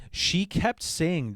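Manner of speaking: usual speaking voice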